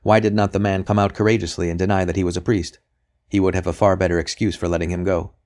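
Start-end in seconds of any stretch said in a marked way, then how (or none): none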